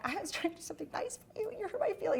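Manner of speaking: small, high, soft wounded voice; mock choked-up hurt. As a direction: imitate a tearful plea, high and quiet. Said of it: "getting all choked up".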